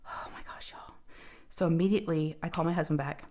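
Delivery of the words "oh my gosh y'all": breathy whisper of relief, much softer. Real, not acted. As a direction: whisper it like you can finally breathe. Relieved aside to viewers.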